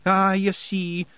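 high Scottish character, chatting casually; easy-going Scots guide voice; conversational Scots. A narrator's reading. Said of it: "said Rob conversationally".

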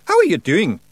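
high, loud, cheery Scots greeting; cheerful. A narrator's reading. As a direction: hail him breezily in Scots. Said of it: "said Rob Anybody cheerfully".

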